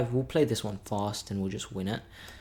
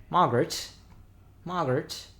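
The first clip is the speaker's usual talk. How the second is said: put-on English accent; 5 semitones higher than usual. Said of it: "I've been doing an English accent".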